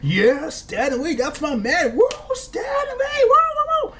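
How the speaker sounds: hammed-up high cheering, woo shouted; mock cheering fan. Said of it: "Nobody was like".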